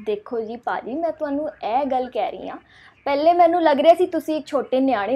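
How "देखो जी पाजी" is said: ordinary talk, nothing marked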